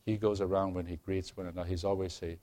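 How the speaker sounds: ordinary talk, nothing marked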